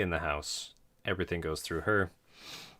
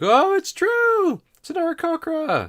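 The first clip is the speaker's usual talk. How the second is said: excited crowd voice; 9 dB louder than usual. Acted. Tavern patron shrieking at the birdfolk's arrival. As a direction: shout it like a stunned tavern patron. Crowd member shouting in awe.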